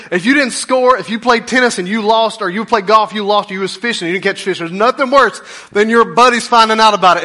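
loud, high preaching build; full-force preaching. Acted. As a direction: preach it hard and fast to the crowd.

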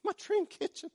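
high, soft mock gushing wife; hammed-up delighted homeowner in falsetto. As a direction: squeal sweetly over the kitchen. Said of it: "all the people are like".

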